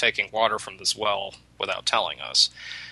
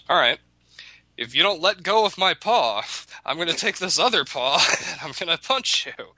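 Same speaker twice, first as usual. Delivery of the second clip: higher, hammed-up fuming rabbit; mock threat; 8 semitones higher than usual. Stuck rabbit threatens the tar wolf, mock angry. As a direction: bluster at the wolf, a laugh slipping in. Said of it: "then he says".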